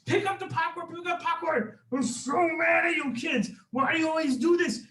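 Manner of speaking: high-pitched furious scolding; screaming mad. Acted. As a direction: shriek at the kids in exasperation.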